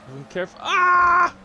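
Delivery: sudden high cry of shock; startled shout breaking off; shocked yelp. Real, not acted. Shock at crash on track.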